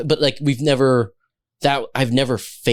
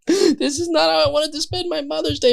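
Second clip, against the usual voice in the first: high, whiny imitated sob; mock crying. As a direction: whine it like a weeping mother. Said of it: "she's crying".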